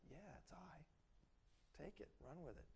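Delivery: slow, soft, sly voice; the enemy. Retold or imitated. The enemy gloating at the listener, slow and soft. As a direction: draw it out slyly and quietly. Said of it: "the enemy's like".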